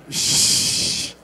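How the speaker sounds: unvoiced shush; hushing. Real, not acted. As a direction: hush the crowd. Hushing the crowd.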